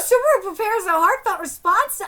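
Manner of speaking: normal talking voice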